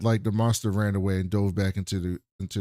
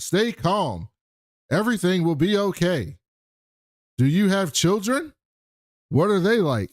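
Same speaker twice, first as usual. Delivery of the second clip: higher-pitched robot voice with separated phrases; 7 semitones higher than usual. Retold or imitated. Robot trying to keep her calm, high robotic voice. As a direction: soothe like a robot, pausing between sentences. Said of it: "He says it like a robot".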